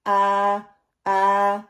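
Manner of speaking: آ from the bottom of the throat; hamza sound. Shown as a demonstration. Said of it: "وہاں سے یہ آئے گا".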